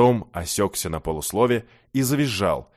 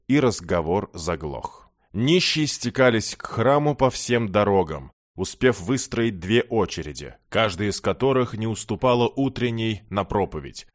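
usual speaking voice

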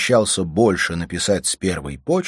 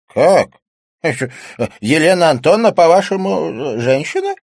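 loud, high character voice; high-pitched, blustering disbelief; cantankerous old-school cynic, incredulous; 12 semitones higher, 9 dB louder than usual. A narrator's reading. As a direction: challenge it loudly, with hesitations.

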